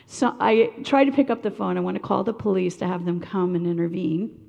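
usual speaking voice

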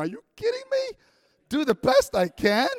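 high, loud, hammed-up disbelief; incredulous protest. Student protesting to the dean before presenting, incredulous protest. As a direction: throw the advice back, squeaking then slowing in outrage. Acted disbelief at dean's advice.

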